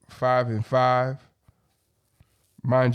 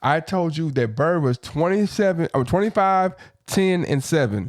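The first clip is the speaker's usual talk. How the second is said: louder, higher, emphatic argument; heated rebuttal; 4 semitones higher, 5 dB louder, faster than usual. Real, not acted. Arguing back about Bird's stats, heated rebuttal. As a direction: talk over them and insist, voice raised.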